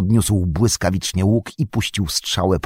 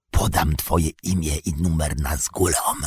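lowered, softer menacing voice; hissing whisper; 7 semitones lower, 6 dB quieter than usual. Acted. Orc warrior threatening to report the tracker, in a lowered hiss. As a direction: drop the voice to a hissed threat. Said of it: "zniżając głos do syczącego szeptu".

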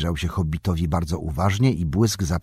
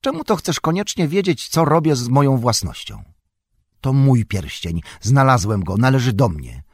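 angry outburst; 6 semitones higher, 3 dB louder than usual. A narrator's reading. Bilbo angrily claims his ring.

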